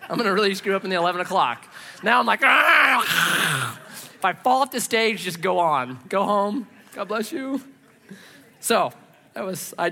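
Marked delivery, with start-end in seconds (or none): 2.4-3.8 mock hyper shout. Imagines caffeine overload.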